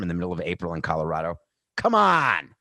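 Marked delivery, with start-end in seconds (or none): 1.8-2.5 incredulous come on. Real, not acted. Disbelief at April snowstorm.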